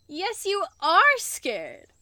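loud, slow, high gleeful taunt; gleeful teasing. Acted. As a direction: savour their fear out loud. Teasing partner's fear.

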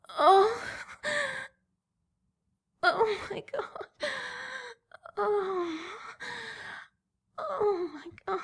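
high, loud cries of pleasure; loud moaning. Acted. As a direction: let the moans ring out high. Moaning climax in roleplay.

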